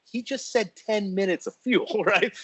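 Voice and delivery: high, slow incredulous question; drawn-out astonished query; retold disbelief. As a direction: stretch it out high in mock alarm. Retold alarm at low fuel.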